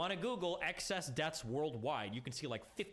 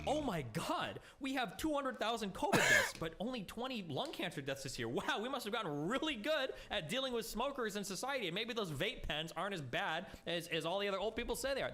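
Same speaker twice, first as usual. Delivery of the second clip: mock hospital staff; 7 semitones higher than usual. Debater mocks imagined hospital officials with hammed-up, higher mock amazement. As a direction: play a gushing official who notices nothing, pitched up. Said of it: "it's not like".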